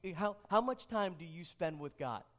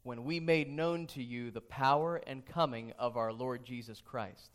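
lower, unhurried reading voice; scripture reading; 5 semitones lower than usual. Acted. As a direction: read the passage solemnly to the congregation. Scripture reading before sermon.

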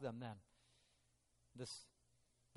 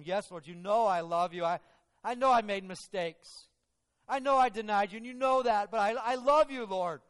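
louder, higher pleading declaration; earnest plea; 6 semitones higher, 11 dB louder than usual. Acted. Peter answering Jesus on the shore, loud earnest plea. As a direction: pour it out, pleading with the Lord.

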